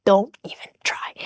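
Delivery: whispered. Real, not acted. Dismissing her ex's excuses, fading to a whisper. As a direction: let the phrase fade into a whisper.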